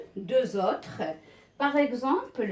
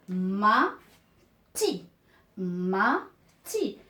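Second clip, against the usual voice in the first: syllable-by-syllable reading; 3 semitones lower, much slower than usual. Shown as a demonstration. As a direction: sound out the two syllables separately. Teacher demonstrates joining two syllables. (lecture détachée, syllabe par syllabe)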